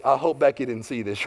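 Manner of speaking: low, hushed aside to yourself; private worried thought. Retold or imitated. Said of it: "my first thought is".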